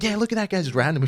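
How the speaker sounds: high, loud excited voice; mock eager kid. Kid urging his brother to beat a stranger, high and excited. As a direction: play the eager little brother pointing. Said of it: "He'd be like".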